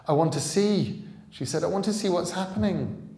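insist, eager and anxious; urgent. Acted. Scarlet insisting.

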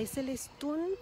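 questioning Celestún, rising on TÚN; slow rising "Celestún", end-stressed; stress on last syllable. Shown as a demonstration. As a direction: ask it, leaning on the final U of Celestún. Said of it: "emphasis is on the last syllable".

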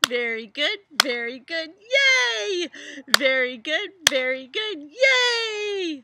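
loud high cheer. Real, not acted. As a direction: cheer them on, loud and bright. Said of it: "cheering after exercise".

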